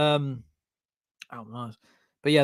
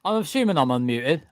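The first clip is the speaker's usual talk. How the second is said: ordinary delivery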